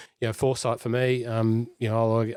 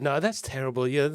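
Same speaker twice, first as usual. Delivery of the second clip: ordinary delivery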